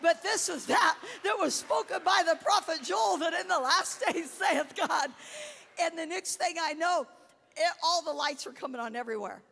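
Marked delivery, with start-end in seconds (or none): 0.0-5.1 loud shouted scripture, laughing into tears at the end; yelling scripture. Retold or imitated. From the ground she yells Joel's prophecy to the neighbors, shouting. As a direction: yell it up from the snow like preaching. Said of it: "I'm yelling".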